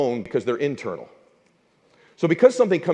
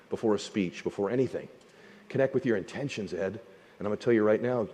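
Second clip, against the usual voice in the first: gentle advice; 4 semitones lower, 9 dB quieter than usual. Retold or imitated. Mentor gives quiet advice.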